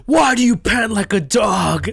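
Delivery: loud stern teacher bark; mock shouting teacher. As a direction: yell it like a mean sensei. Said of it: "he was just like".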